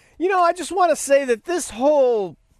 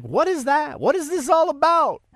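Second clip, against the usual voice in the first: higher-pitched mock bewilderment; baffled questioning; 4 semitones higher than usual. Driver puzzling over all the flags, baffled. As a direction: ask it like you're totally baffled.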